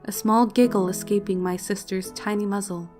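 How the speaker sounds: ordinary delivery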